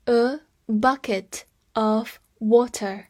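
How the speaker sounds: slow, careful repeat; teacher repeating slowly. Shown as a demonstration. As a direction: say "A bucket of water" slowly for students.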